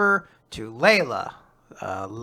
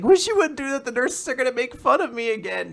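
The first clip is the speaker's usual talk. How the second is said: high-pitched mock pouting complaint; cutely upset; 8 semitones higher than usual. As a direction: whine sweetly in imitation. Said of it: "in a cute way, she's very upset".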